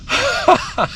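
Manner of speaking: very high chuckling laugh; delighted giggle. Real, not acted. Angler gloating over flathead, high giggling laugh.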